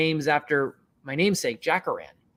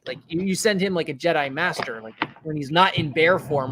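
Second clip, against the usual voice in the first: usual speaking voice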